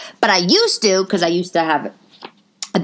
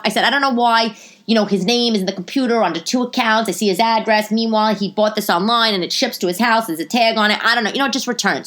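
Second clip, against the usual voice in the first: high, fed-up outburst; raised, insistent customer complaint; retold exasperation; 6 semitones higher, 3 dB louder than usual. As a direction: act like you've had enough. Fed-up customer snaps at saleswoman.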